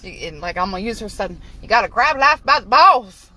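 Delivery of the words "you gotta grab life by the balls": slow, louder quote of grandma. Retold or imitated. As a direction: punch the grandma line slowly. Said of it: "That's what she said".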